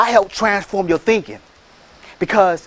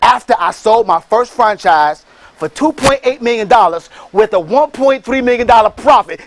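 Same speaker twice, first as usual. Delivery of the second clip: ordinary delivery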